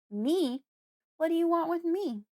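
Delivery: high, loud frightened cry; panicked girl. A narrator's reading. Girl recoiling from Fred, panicked and loud. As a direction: panic rising in every word. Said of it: "I'm panicking now".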